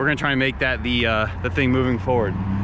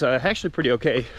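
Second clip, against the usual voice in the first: ordinary delivery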